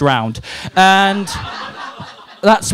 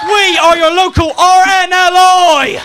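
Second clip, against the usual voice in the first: big slow crowd shout; announcer shout; 6 dB louder, slower than usual. Acted. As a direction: call it out to the whole crowd.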